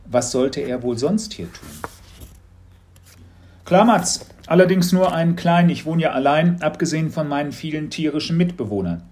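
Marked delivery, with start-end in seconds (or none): 3.6-9.0 lightly raised friendly voice; a cheerful chat with Mats; lightly voiced friendly adult; friendly caller. A narrator's reading.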